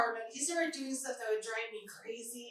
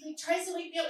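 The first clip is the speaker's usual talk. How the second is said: ordinary talk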